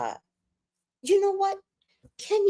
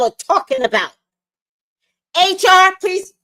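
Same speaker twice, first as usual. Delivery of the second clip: higher and louder, shouting at the commenters; furious rant; 8 semitones higher, 6 dB louder than usual. Real, not acted. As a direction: yell it at the haters online. Ranting at TikTok commenters.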